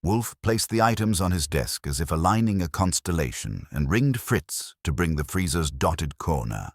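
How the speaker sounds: plain reading voice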